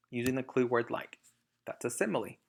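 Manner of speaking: ordinary delivery, nothing marked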